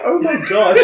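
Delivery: high-pitched, laughing repeat of a punchline; cracking up while quoting; amused echo. Retold or imitated. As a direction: parrot it back, breaking into laughter. Laughing as they echo the joke.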